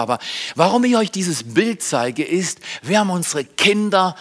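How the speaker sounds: ordinary talk, nothing marked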